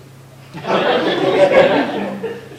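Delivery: high airy laugh. Real, not acted. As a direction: burst out laughing. Laughing at Derek.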